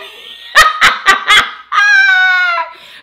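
big shrieking laugh, high and loud. Real, not acted. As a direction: burst out laughing loudly. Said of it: "I was going through all them emotions too".

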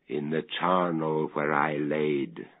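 ordinary delivery, nothing marked

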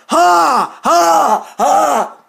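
high, shouted mock laugh; defiant ha. Real, not acted. Crowing to the hater that they survived, defiant ha. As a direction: throw out each ha separately in defiance.

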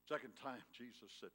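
usual speaking voice

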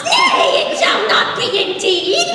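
very high, loud shriek of NAY; frantic pig refusal. Acted. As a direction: squeal NAY, then forbid it theatrically. Pig blocks the door.

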